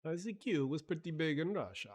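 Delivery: put-on quick joking Russian accent; mock Russian accent. Streamer jokes to chat about ICQ, mock Russian accent. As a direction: toss it off in a hammed-up Russian accent. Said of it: "I'm not gonna do that accent ever again".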